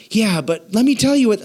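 high, butting-in objection; protesting listener. Acted. Offended listener insisting on telling the pastor, high-pitched protest.

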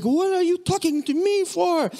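high, hammed-up mock cheerfulness with big smiles; over-exaggerating Frenchman. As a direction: overplay the bewildered French visitor. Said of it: "he was kinda over-exaggerating".

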